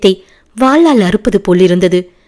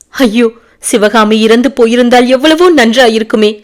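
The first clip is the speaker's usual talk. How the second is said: higher, louder wailing lament; 4 semitones higher, 4 dB louder than usual. A narrator's reading. Grieving father wailing to the prince, loud lament. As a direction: cry it out in despair. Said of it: "அலறும் குரலில்".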